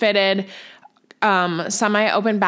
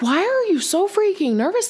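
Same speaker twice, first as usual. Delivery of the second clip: high, rising disbelief; incredulous question; 11 semitones higher than usual. Retold or imitated. Friend teasing the nervous bride, incredulous high rise. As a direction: ask like you can't believe it. Someone asks why she's nervous.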